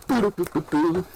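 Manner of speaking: quick run of high-pitched boops; imitated beeping. Retelling a funny movie scene, high mock beeping. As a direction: sound out little high beeps.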